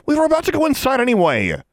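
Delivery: high, loud angry retort. A narrator's reading. Alexandra retorts to Larry, angry. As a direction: spit it back. Continues snarling.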